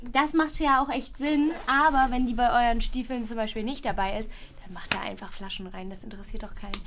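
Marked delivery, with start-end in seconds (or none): 4.6-6.7 soft, almost whispered; quiet aside. Real, not acted. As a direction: drop your voice to a near whisper. Drops voice, confiding aside. (leise, fast geflüstert)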